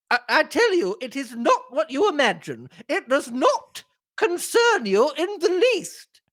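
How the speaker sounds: hammed-up high-pitched indignant denial; Missus Inglethorpe. A narrator's reading. Acted quarrel, old lady refuses.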